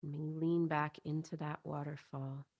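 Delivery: soothing guided-visualization voice, spacious phrasing; guided meditation. Acted. Leads a chakra meditation.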